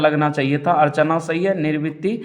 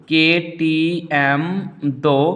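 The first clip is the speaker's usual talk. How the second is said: "के टी एम दो" very slow, piece by piece; slow dictation; much slower than usual. Shown as a demonstration. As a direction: spell out "के टी एम दो" slowly for memorising. Repeats mnemonic to be memorised.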